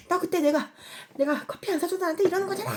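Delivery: loud, high-pitched mock outburst; hammed-up accusation. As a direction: throw a pretend tantrum of blame. Acts out an unreasonable accusation.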